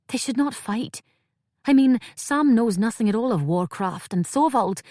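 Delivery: Cray fretting, voice lifted with worry; anxious young Cray. A narrator's reading.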